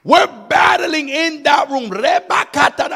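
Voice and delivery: shouted preaching, voice up high and loud. Acted. Preacher to congregation, telling of a spiritual battle, shouting. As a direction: cry it out like a revival preacher.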